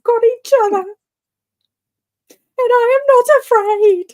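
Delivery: high, loud, overblown declaration; mock brave. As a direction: play the fearless heroine over the top. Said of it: "Too soon".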